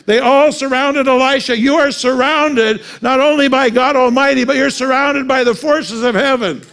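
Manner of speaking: high, loud preacher's proclamation; preaching at full force. Acted. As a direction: preach it out to the congregation. Preaching climax.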